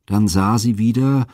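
usual speaking voice

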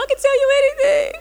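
hammed-up crying, very high and fast. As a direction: mimic a squeaky wail breaking into laughter. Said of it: "And I'm crying".

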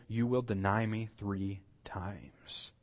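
slow, whispered solemn foretelling; hushed prophecy. Acted. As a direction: let it sink slowly into a whisper. Remembered solemn prophecy of Jesus.

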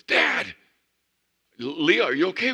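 loud, high alarmed call down the phone; alarmed shout. Retold or imitated. Daughter shouting down the phone to her dad, loud and alarmed. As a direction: yell it like a panicked dad.

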